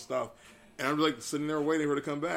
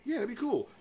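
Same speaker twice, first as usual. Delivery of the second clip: much higher-pitched mimicry of a date; imitated casual agreement; 12 semitones higher, 3 dB louder than usual. Date agreeing to plans, high imitated agreement. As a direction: put on her high, breezy yes. Quoting date's easy agreement.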